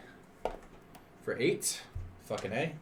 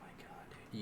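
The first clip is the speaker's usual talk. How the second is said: breathless whispered disbelief; whispering; 18 dB quieter, faster than usual. Real, not acted. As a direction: whisper it in exasperation. Disbelief at a bad roll.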